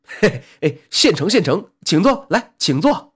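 fawning innkeeper. Acted. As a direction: greet the customer with a fawning grin. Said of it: "堆起一脸的笑容". (满脸堆笑的殷勤招呼)

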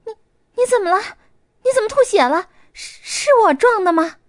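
高而响的惊慌尖叫; 撞了人后惊恐地连声追问; 吓坏了. A narrator's reading. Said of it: "伊娜吓坏了". (惊慌尖叫)

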